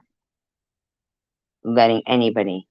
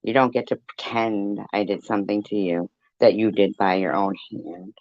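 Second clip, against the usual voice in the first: ordinary talk, nothing marked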